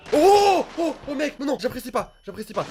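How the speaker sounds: high startled yelp; startled cry. Real, not acted. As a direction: yelp in fright. Jump scare.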